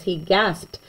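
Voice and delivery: ordinary delivery, nothing marked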